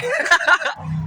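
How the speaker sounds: very high burst of laughter. Real, not acted. Laughing at the stranger on camera, very high. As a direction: cackle with delight.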